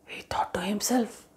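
soft whispered aside. A narrator's reading. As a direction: drop to a hush. Narration aside after wolf's thought.

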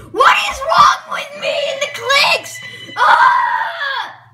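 high, loud, slow frustrated cry; frustrated shout. Real, not acted. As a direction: yell at the misbehaving phone. Phone keeps misclicking.

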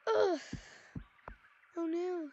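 ordinary talk, nothing marked

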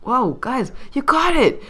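high, loud excited gratitude; gushing thanks. Retold or imitated. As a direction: squeal thanks at the heroes. Owners thanking them excitedly.

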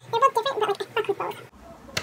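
high mock voice fading quietly; high playful voice. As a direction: say it again in a high, fluttery voice. Repeated the line in another voice.

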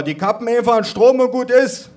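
high, loud interjection; grandpa calling out. A narrator's reading. Grandpa answers about the smoke detectors, loud calling. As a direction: call it loudly across the room. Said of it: "rief mein Opa".

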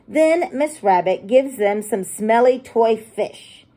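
ordinary delivery, nothing marked

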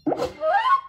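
sharp high-pitched gasp. Acted. Storyteller to children mid-story, startled gasp. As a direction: gasp loudly in mock surprise.

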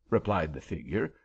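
ordinary delivery, nothing marked

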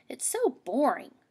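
put-on bored kid rabbit whining; whiny bunny complaint.

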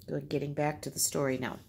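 ordinary talk, nothing marked

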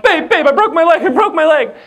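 high, loud comic wail to his wife; overacted injured husband yelling for help; hammed-up panic. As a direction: cry out in mock alarm. Retold panicked cry to wife.

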